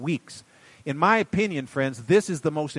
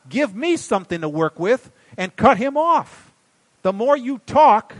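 higher, louder, hammering each warning; preaching at full force; 8 semitones higher, 5 dB louder than usual. Acted. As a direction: scold the flock from the pulpit, full throttle. Preacher rebukes the congregation.

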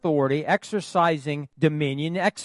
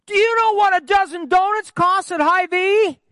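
much higher, loud imitation of his excited wife; 6 dB louder than usual. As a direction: squeak it out like the wife back from groceries. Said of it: "her voice gets higher when she's, you know, excited".

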